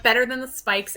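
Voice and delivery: ordinary talk, nothing marked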